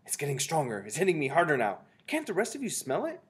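loud voice rising high; urgent witch. A narrator's reading. Mildred urging the other witches to smell, rising urgency. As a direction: build to a high, insistent question.

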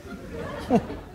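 high unvoiced shy giggle; breathless giggle. Young master embarrassed to confess, breathy titter. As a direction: giggle bashfully under your breath.

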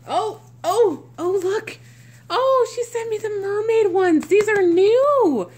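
high, loud gushing; real squealing delight; excited discovery. Real, not acted. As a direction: burst out thrilled at a surprise find. Excited discovery of mermaid diapers.

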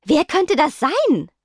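high, slow, baffled question; shocked. A narrator's reading. Venus wonders who the source could be, shocked. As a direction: ask it slowly in startled disbelief. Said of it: "Venus geschockt".

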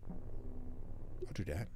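soft slow whisper back. Real, not acted. Streamer whispers back to the game character, slow.

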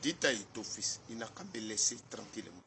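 ordinary talk

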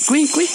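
high little cuy chirps; imitated chirps. Streamer mimicking the game, high chirps. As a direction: chirp like a tiny critter.

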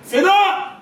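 ordinary talk

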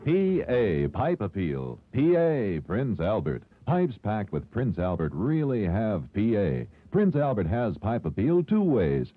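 usual speaking voice

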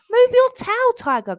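high, loud cross opening; huffy storybook bear; grumbling. A narrator's reading. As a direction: grumble it as an irritated order. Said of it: "grumbled Bear".

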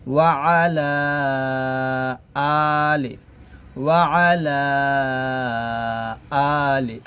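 drawn-out chanted Arabic; measured, sustained teaching recitation; slow recitation. Acted. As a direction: chant slowly, humming the doubled m.

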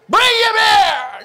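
slow, high, loud command; king's shouted order. Acted. The furious king orders the servant dragged before him, shouting. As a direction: roar it across the hall.

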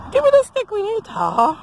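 very high demand to a dog; high-pitched doggy talk; playful pet command. Real, not acted. Playing fetch with her dog.

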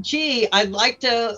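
ordinary talk

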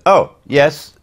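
high, loud eager agreement; eager yes. Retold or imitated. Actor caught between actress and girlfriend answers eagerly. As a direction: blurt it out keen and bright. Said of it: "So I said".

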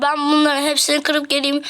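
ordinary talk

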